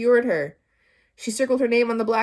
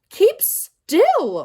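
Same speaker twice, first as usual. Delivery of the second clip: much higher, loud sharp order; 13 semitones higher, 10 dB louder than usual. A narrator's reading. Teacher ordering Todd to freeze. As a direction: bark the command. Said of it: "ordered Missus Jules".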